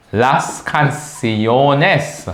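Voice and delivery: very slow, syllable-by-syllable "canciones"; slow teacher's model of the word; drawn-out plural. Shown as a demonstration. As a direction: stretch "canciones" out for learners.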